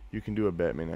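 normal talking voice

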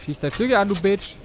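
yelled curse at the dragon; furious battle yell; angry shout. Real, not acted. Shooting the dragon's wing.

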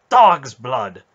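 loud furious oath; swearing. A narrator's reading. Knight swearing at the whipping of slaves, loud and furious. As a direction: spit the curse out loud. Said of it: "Manderallen swore".

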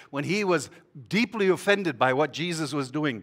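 ordinary talk, nothing marked

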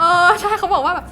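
co-owner bursting out excitedly while recalling a loyal customer. Real, not acted.

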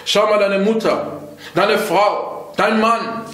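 ordinary talk, nothing marked

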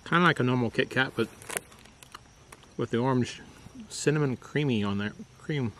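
talking between bites; muffled by food in mouth; mouth full, chewing. Real, not acted. Seen 0: Bites bar at -4; cheeks full, jaw chewing through labelled words.

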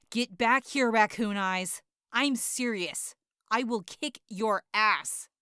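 slow, loud menace; angry yelling. Acted. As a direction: spell out the threat, yelling. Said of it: "when I was yelling at Raccoon Eyes".

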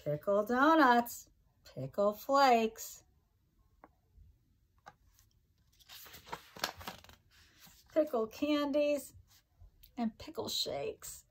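sing-song rhyming read-aloud, each line bouncing; reading to children. Acted. Teacher reading a pickle rhyme book to her class, sing-song. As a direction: bounce the rhymes for little listeners.

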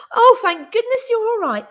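high, loud relieved cry; Judy crying out with relief. A narrator's reading. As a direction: burst out in relief. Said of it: "cried Judy".

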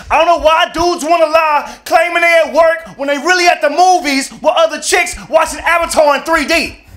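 high, loud mock rant of an angry girlfriend. Women's angry Facebook rant voiced.

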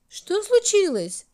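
high, loud call across the castle. A narrator's reading. Hour calls out to the new little minute, loud and high. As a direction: call out to her, worried. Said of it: "окликнул он новую Минутку".

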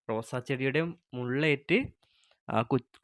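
usual speaking voice

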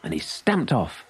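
ordinary delivery

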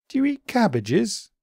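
high, small puzzled bunny voice; a high, wondering little creature; Daily B's voice. A narrator's reading. Daily B asks.

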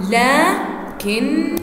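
لكن very slow, long first vowel, held n; drawn-out pronunciation. Shown as a demonstration. Teacher showing how it is pronounced, لكن slowly sounded out. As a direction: stretch لكن into two separated syllables. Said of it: "لكن شو بنلفظها".

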